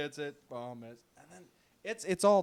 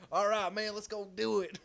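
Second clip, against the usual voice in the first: higher, louder cheerful reply; upbeat agreement; 7 semitones higher, 5 dB louder than usual. Retold or imitated. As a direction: sound game and eager. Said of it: "I'm like".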